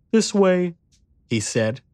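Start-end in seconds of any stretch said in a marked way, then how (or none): 0.1-0.7 put-on high-pitched little boy voice; young boy. A narrator's reading. Boy leading the narrator to escape, child voice.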